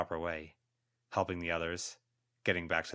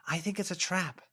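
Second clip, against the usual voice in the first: ordinary delivery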